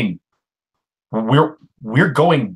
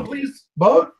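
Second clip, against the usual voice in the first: pleading; 14 semitones higher than usual. Acted. Pleading for a boat.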